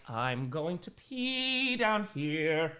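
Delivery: slow, drawn-out put-on voice; lightly voiced slow announcement; Nova. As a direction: stretch it out as a mock quote. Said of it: "but then Nova was like".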